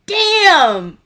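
a high, loud burst of shock; stunned exclamation. Retold or imitated. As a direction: blurt it out, caught off guard. Gripped by the play onstage.

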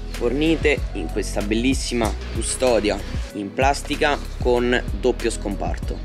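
normal talking voice

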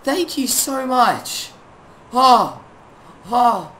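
high, loud, drawn-out elation; real overjoyed shout. Real, not acted. Gamer thanking friend for a gift, overjoyed shout. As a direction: shout your thanks, voice soaring.

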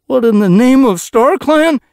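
high, very loud outburst; shocked exclamation. A narrator's reading. Running Nose seeing the body, shocked outburst. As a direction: exclaim in alarm at the sight.

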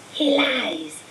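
a cold, distant spirit voice accusing; eerie high voice. A narrator's reading. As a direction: hiss the accusation like a disembodied spirit. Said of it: "a high voice spoke".

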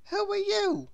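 put-on high, curious child's voice; small boy meeting a stranger; little boy voice. A narrator's reading. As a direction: ask like a wide-eyed boy.

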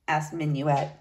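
ordinary delivery, nothing marked

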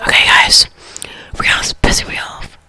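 annoyed ASMR whisper. Real, not acted. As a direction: whisper with mild irritation.

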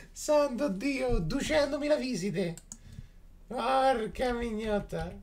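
high, slow drawn-out cry; shocked exclamation. Real, not acted. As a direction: cry out in disbelief.

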